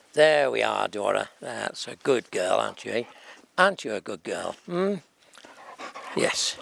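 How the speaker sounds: calm the dog down gently; soothing a dog. Real, not acted.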